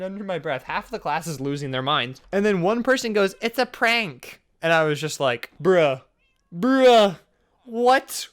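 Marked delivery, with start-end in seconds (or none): 3.4-4.4 high, stretched-out tattle; mock calling out. Classmate outs the prank to the teacher, mock call-out. Classmate calls out, giving him away. 5.6-8.3 louder, rising mock outrage; indignant disbelief. Student reacts to being ratted out, mock indignant.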